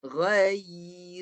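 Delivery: "Ghairi" slow, the middle y held before ri. Shown as a demonstration. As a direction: hold a clear y in the middle of "Ghairi". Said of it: "Ya kena naik tengah lidah".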